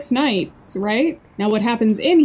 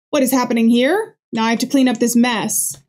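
ordinary talk, nothing marked

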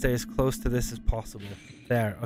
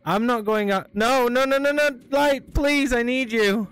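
panicked pleading; 8 semitones higher, 3 dB louder than usual. Real, not acted. Panicking in dark chase.

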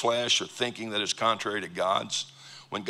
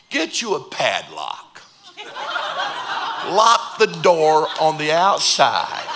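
slow, louder, laughing through the joke; tongue-in-cheek preacher advice with laughter; flippant joking advice; 5 semitones higher, 6 dB louder than usual. Retold or imitated. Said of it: "It's just real flippant".

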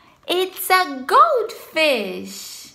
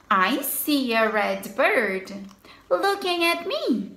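ordinary delivery

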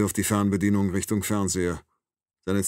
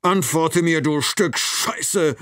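much louder and higher, slow on the insult; furious shout; 9 semitones higher, 5 dB louder, much slower than usual. A narrator's reading. Doctor losing control at his kidnapper, furious yell. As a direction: explode at him, spitting out the insult. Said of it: "und dann lauter".

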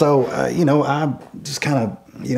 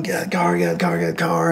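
retold angry yelling; 6 semitones higher, much faster than usual. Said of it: "he's yelling".

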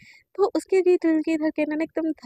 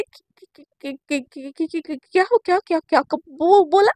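stuttering ki-ki-ki, getting louder; flustered stammer; 4 dB louder than usual. Retold or imitated. Girl stunned by her crush's question, stammering. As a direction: stammer in total confusion. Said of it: "उसको तो समझ नहीं आता".

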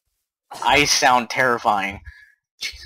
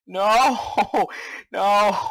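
panicked wail; 8 semitones higher than usual. Real, not acted. Player dreading what's coming in the game, panicked. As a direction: wail it out, raised pitch.

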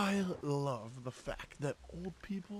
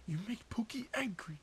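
soft unvoiced menacing whisper; whispered threat; 6 semitones higher, 10 dB quieter than usual. Mock boyfriend warns Pookie in a whisper. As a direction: breathe the threat under your breath.

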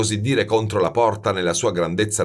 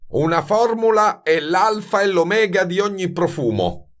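high, emphatic pronouncement; severe; 11 semitones higher, 3 dB louder than usual. A narrator's reading. Baldini wants to end the talk, severe. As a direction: lay down the law sternly.

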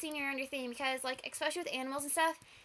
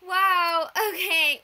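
overwhelmed joy; 3 semitones higher, 12 dB louder, much slower than usual. Real, not acted. Overcome after winning.

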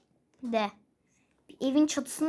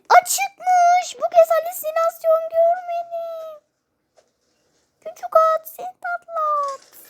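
much higher, louder cooing voice; cutesy voice; 6 dB louder than usual. Acted. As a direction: coo at the little horse like a baby. Cooing to a little horse.

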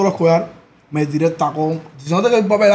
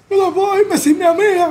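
put-on high pleading girl's voice, slower; 11 semitones higher than usual. The girl refuses to leave her friend, pleading high. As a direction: plead like a tearful young woman.

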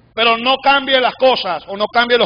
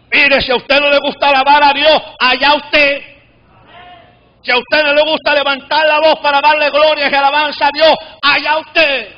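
raised, ringing voice with long stretched endings; impassioned preaching; 5 semitones higher than usual. Real, not acted. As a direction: exhort the crowd with full conviction. Impassioned preaching at full force.

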